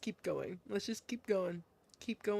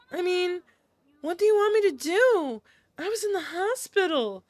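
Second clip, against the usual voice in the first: put-on higher, protesting girlish voice; defensive girl character; 5 semitones higher than usual. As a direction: defend yourself to a friend in a higher voice. Same character defends herself.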